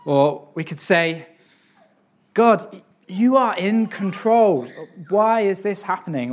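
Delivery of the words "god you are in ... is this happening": pleading frustration. Shown as a demonstration. Preacher showing a frustrated prayer to God, higher and anguished. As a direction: cry out to God in distress. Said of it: "Almost the, the same words, but in kind of a different, tone".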